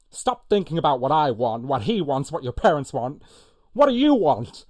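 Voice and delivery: loud, high, building to a shouted question; serious film outburst. Acted. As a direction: hammer out the list, peak on the last question. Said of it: "oh, this is a serious one".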